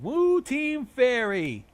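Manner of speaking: high, drawn-out loud woo; lone cheering supporter. A narrator's reading. Lone voice backing the fairy team, high drawn-out cheer. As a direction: cheer slowly and loudly in a high voice. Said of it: "one Solidarity voice in the background going".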